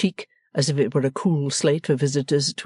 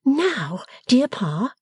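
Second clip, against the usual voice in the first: higher-pitched daughter voice; affectionate Bella; 11 semitones higher, much slower than usual. A narrator's reading. Bella holding Pa by both ears, affectionately high. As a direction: address a dear father, pitched up.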